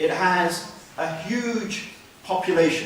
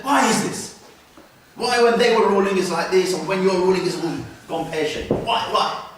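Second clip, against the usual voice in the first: raised, louder mock complaint; condescending; 4 dB louder than usual. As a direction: imitate a condescending challenger, pitched up at the start. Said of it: "saying it in a very condescending way".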